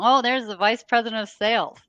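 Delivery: high, loud playful teasing call; teasing crowd. Retold or imitated. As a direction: call it out like a ribbing crowd at dinner. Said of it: "everybody was laughing, going".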